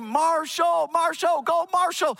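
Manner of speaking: loud high cheering chant; cheering crowd chanting a runner's name. Retold or imitated. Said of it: "they would yell his name".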